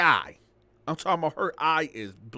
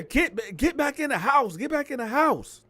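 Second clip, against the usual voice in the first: shouted order; 9 semitones higher, 3 dB louder than usual. Retold or imitated. Shouting heard outside at night.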